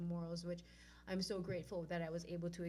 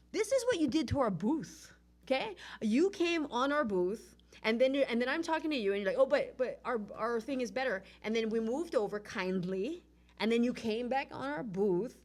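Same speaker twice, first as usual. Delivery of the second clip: loud, heated telling-off; indignant scolding; 3 semitones higher, 7 dB louder than usual. Retold or imitated. Scolding organizers who took over their booth, loud and indignant. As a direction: scold them, raised voice.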